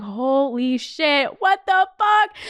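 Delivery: slow mock-stunned exclamation; retold amazement. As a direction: stretch each word in stunned disbelief. Retelling her amazement at yachts.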